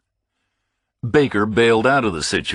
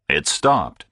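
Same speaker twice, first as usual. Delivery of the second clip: normal talking voice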